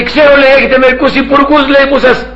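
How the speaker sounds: high-pitched irate caller; imitated yelling. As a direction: mimic someone ranting down the line. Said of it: "Αυτός φώναζε".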